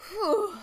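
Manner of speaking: loud high relieved whew; breathy whew. Acted. Daring catching her breath after laughing, whew. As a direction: blow out the breath.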